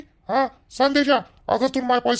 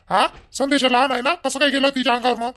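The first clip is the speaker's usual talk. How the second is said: angry demand; 4 semitones higher than usual. Acted. Son-in-law demanding why the younger brother attacked his wife, rising anger. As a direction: build to a furious challenge.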